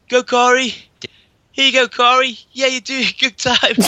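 high, gentle cheering for a runner; a mild, understated Canadian cheer; cheery Canadian cheerleader. Retold or imitated. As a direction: egg Corey on, sweetly and politely. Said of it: "the Canadian guy's like".

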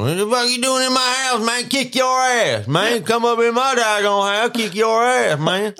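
high, loud, threatening thug voice; over-the-top menacing drunk; hammed-up thug Morphin. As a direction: yell it like a drunk tough guy waving a knife. Morphin pulls a knife, threatening.

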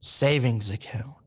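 low, whispery command; hushed mysterious voice; voice of God. Acted. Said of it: "And the voice goes".

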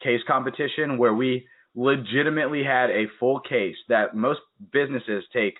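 ordinary delivery

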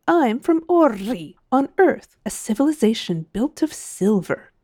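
lifted, boastful storybook character line; proud. A narrator's reading. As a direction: announce your homeland with pride. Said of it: "said Miss Er-Er proudly".

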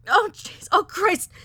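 loud, high, panicked wailing; high distressed cries; pathetic wail. A narrator's reading. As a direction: wail helplessly in pain and panic. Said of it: "she wailed pathetically".

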